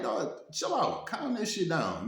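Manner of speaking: high, pressing "chill out" to a friend; urgent calming plea. Retold or imitated. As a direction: hurry to defuse it, voice up. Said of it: "I'm like".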